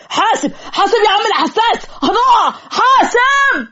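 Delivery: high-pitched alarmed yell, last cry stretched out; panicked warning shout. Acted. Rider to his daydreaming friend as the donkey bolts, panicked shout. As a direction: cry out in alarm as you are about to fall.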